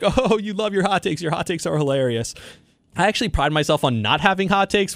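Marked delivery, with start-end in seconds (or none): 0.0-2.3 teasing dad voice, pitched higher; imitating dad. Father teasing his son about hot takes, mock higher teasing voice. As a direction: mimic your father ribbing you about your takes. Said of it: "He's like".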